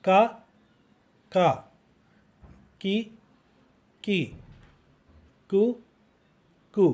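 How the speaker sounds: Ka, ki, ku with a plain k sound; each of Ka, Ki, Ku said separately with a k; kaf sound drill. Shown as a demonstration. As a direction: drill Ka, Ki, Ku syllable by syllable, pausing between. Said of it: "kaf or k".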